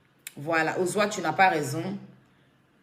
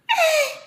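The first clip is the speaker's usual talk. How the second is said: high laughing squeal of "Eh"; 5 dB louder than usual. Real, not acted. Amused by her Bété side, a high laughing squeal. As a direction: burst into a high-pitched laughing "Eh".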